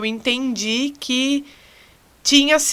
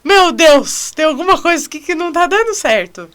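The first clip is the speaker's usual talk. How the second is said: high, loud alarmed cry; imitating sudden panic; panicked inner voice; 8 semitones higher, 8 dB louder than usual. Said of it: "Chega assim, tipo".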